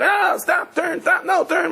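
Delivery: high-pitched panicky shouting; backseat driver. Acted. Backseat driver to whoever is driving, frantic shouting. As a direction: yelp frantic orders at the driver. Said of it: "my backseat driver".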